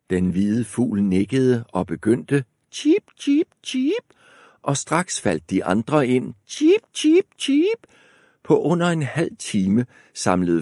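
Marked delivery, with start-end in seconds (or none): 2.7-4.0 very high, chirpy bird sounds; bird chirp. Acted. 6.5-7.9 very high, chirpy bird sounds; bird chirp. Acted. Birds chirping.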